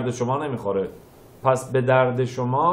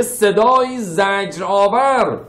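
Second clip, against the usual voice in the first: higher, louder and slow, full of pain; pained, slow, louder lament; anguished; 6 semitones higher, 4 dB louder than usual. Acted. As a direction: stretch it out like hearing torment. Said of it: "این صدای ناله و زجره".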